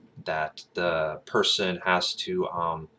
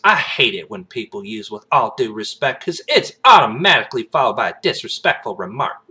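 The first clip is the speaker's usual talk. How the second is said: sassy Southern complaint, higher and louder; put-on Southern belle with attitude; Agent Bloomis; 4 semitones higher, 7 dB louder than usual. Agent objecting to "with all due respect", sassy Southern. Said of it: "she's such a sassy woman".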